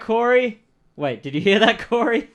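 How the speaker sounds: high, loud shout toward the back room; calling out loud. Acted. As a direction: bellow the name across the shop. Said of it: "two different voices that you're doing".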